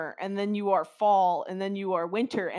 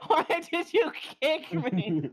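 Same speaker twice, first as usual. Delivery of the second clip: laughing protest; 7 semitones higher than usual. Real, not acted. Removed friend asking why he was kicked, laughing high protest. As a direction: protest through laughter, voice pitched up.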